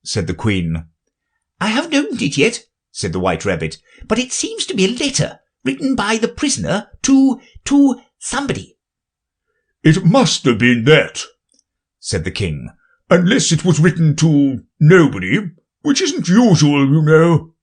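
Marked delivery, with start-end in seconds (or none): 1.6-8.8 high, hurried little rabbit voice. A narrator's reading. 9.8-17.5 louder, self-important royal voice; king explains the letter to the court, pompous and loud. A narrator's reading.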